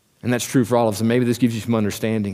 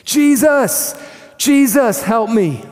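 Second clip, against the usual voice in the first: desperate cry, much higher and louder; 12 semitones higher, 6 dB louder, much slower than usual. Retold or imitated. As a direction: call across the crowd, begging at the top of your lungs. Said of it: "cry out at the top of his lungs".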